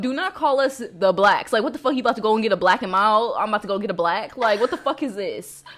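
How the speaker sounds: loud, emphatic acted rebuke; outraged retort, retold at volume; indignant telling-off. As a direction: lay down the law, loudly affronted.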